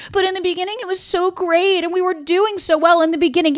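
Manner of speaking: high, sing-along mock lament; mock pleading. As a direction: imitate someone clinging on, pitched well up. Mocking those who cling to beginnings.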